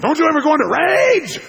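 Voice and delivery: high, loud incredulous yell; enraged question. Retold or imitated. Raging CEO to unruffled buddy, furious shout. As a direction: explode on "rage".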